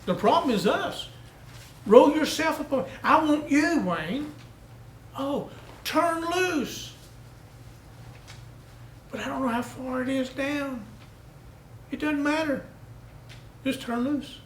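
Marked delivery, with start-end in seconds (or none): 1.8-4.3 raised, higher pleading call of God; a lightly voiced God calling out; God's call. 5.2-14.4 a lightly voiced God coaxing; God urging. God urging man to let go.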